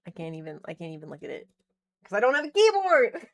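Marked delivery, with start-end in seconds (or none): 2.0-3.3 exasperated shout. Real, not acted. Frustrated streamer without a keyboard.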